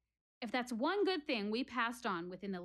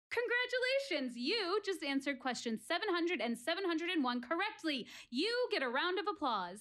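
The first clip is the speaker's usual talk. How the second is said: much higher, bright congratulation cry; game show host; 6 semitones higher than usual. Acted. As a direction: cheer like a quiz host announcing a winner. Announces correct answers like host.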